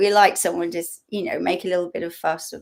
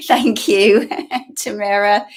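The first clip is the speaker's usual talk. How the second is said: laughing, louder thank-you; amused thanks; 7 dB louder than usual. Real, not acted. Speaker thanks a friend in the live chat, laughing. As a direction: thank her through a laugh.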